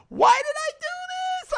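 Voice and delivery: high, drawn-out retold panic wail; screaming and crying. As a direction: act out a tearful, terrified scream. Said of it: "I was screaming and crying".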